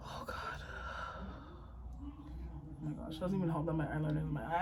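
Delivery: breathy sob, nearly voiceless; trying to talk while crying. Real, not acted. Crying on camera about her eyeliner, sobbing. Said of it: "I've cried too much today already".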